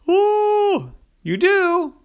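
high, loud mock-scared squeal; mock frightened. Liam frightened.

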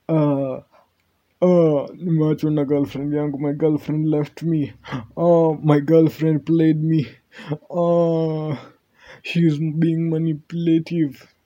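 high, whining complaint; whiny complaining dude. Retold or imitated. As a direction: whine like a dumped boyfriend. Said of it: "the specific dudes who complain".

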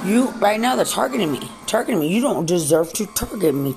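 high, loud and furious; real furious yelling; furious shout. Real, not acted. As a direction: yell it as an accusation. Angry rant at officials.

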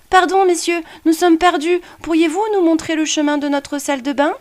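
put-on high, polite young boy; a lost child asking the way; little boy voice. A narrator's reading. Boy character's voice.

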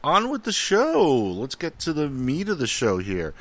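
high, slow mock-announcer call opening the show; showman announcer. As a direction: ham it up like a game-show host announcing the next part.

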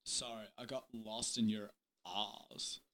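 very soft, low murmur; barely audible, low confession; hushed stage manager. Acted. Jet flirts with the host.

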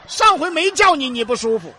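high, loud reproach; exasperated mock outburst. Acting friend arguing.

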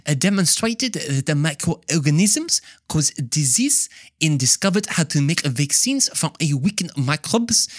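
playful mock French accent; fake French accent. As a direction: lecture in a hammed-up French accent. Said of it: "I said that in my fake French accent".

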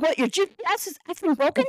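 fast, high mocking question; woman mocking her boyfriend's broken GPS; sarcastic jab. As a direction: needle him about the GPS. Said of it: "I was like".

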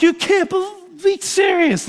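very high imitated disbelief; losing it. Friend overcome at the kitchen table, losing it. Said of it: "he just lost it".

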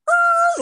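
very high, loud screech; imitated screeching brakes. Friend driving at the man to stop him, screeching brakes. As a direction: imitate a car braking hard. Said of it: "brakes right at him".

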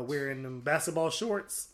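ordinary talk

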